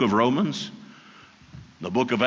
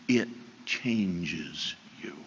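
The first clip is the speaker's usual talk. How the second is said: very slow; 5 semitones lower, 7 dB quieter, much slower than usual. Acted. Key point again.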